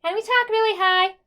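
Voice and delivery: loud, high weird-person voice. Shown as a demonstration. Teacher moves students up into the mid-tone, high voice. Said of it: "put it in a higher voice".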